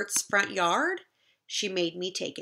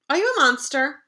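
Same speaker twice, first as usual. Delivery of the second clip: higher, louder, quick character voice; monster kid voice; 6 semitones higher, 7 dB louder, faster than usual. Acted. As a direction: banter like a cheeky kid. Exchange with Bert.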